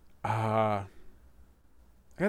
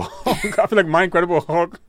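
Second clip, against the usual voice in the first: higher, louder, laughing through the words; 5 semitones higher, 4 dB louder than usual. Real, not acted. Man joking about his skinny Hulk, laughing as he talks. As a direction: crack up at your own joke.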